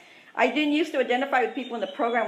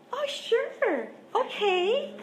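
bright, much higher, overly agreeable; fake cheerful agreement; 11 semitones higher, much slower than usual. Telling boyfriend she'll leave, forced cheerful okay. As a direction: swallow the hurt and chirp along.

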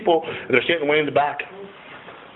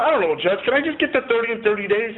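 pleading; 9 semitones higher than usual. Retold or imitated. Pleading with the judge.

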